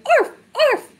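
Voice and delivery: short puppy-like arfs; demonstrating a dog's bark. Acted. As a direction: bark like a dog. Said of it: "Dogs say".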